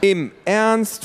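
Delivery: high, loud disbelief; can't believe it; incredulous. Acted. Luisa reacts to the neighbour staying, high and disbelieving. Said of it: "fragte Luisa".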